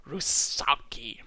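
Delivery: "Rusalki" drawn out slowly with a hissed s; slow, sibilant stress on "Rusalki"; hissing emphasis. A narrator's reading. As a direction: hiss the middle of "Rusalki". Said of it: "Hissing emphasis was placed on this".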